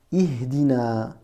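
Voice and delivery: Ihdina said slowly and clearly; slow it down so the pupil hears each sound; slow model word. Shown as a demonstration. Teacher introduces the word Ihdina to a learner, slow and clear. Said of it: "On va faire connaissance avec ce mot".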